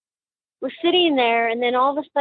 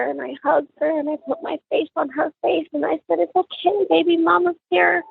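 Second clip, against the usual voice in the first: tearful; 7 semitones higher than usual. Real, not acted. Mother recalling comforting her daughter, tearful and high. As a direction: voice pitched high with real emotion.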